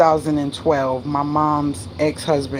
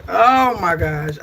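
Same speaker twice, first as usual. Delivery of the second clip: peed off; 2 semitones higher, 4 dB louder, slower than usual. Real, not acted. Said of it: "I am so peed off".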